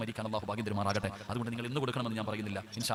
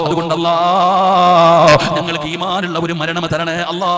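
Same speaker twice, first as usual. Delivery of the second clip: loud, chanted exhortation with drawn-out endings; booming sing-song Malayalam sermon; full-force preaching; 5 semitones higher, 18 dB louder than usual. Real, not acted. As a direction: cry out to the congregation and to God.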